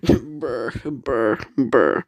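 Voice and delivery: loud mock slurp noises; imitated slurping. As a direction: make noisy tea-sipping sounds. Michael sipping his tea.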